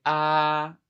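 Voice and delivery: "ah" stretched out high and level; long, even-pitched "ah"; first tone. Shown as a demonstration. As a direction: hold "ah" steady for the doctor. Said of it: "First tone, high and level".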